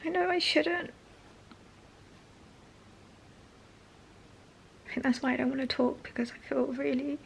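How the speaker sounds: high, cracking and near tears; choked up, fighting tears; voice breaking. Real, not acted. Breaking down mid sentence.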